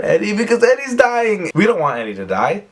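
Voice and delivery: hammed-up high-pitched distress; mock alarm. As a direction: voice soars up in exaggerated panic.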